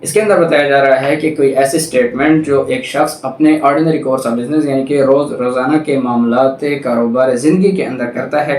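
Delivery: ordinary talk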